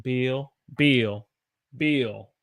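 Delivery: "Bill" said as "beel"; Southern "Bill", rhyming with eel; Southern held vowel. Shown as a demonstration. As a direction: stretch the vowel of "Bill" to a long ee. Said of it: "So I hold it, it's longer".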